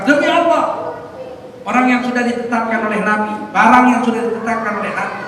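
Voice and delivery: loud, resolute vow; firm oath. Retold or imitated. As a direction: swear it with full conviction. Abu Bakar swears firmly. (sumpah tegas dan lantang)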